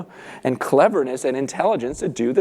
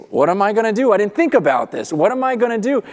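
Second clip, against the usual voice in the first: higher, louder fretting to himself; mock worry; 6 semitones higher, 3 dB louder, faster than usual. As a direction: act out a worried man thinking aloud. Acts manager panicking at being fired.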